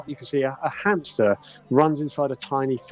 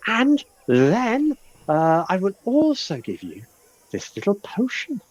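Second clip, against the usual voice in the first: pixie voice; 8 semitones higher, slower than usual. Acted. Pixie offers potion.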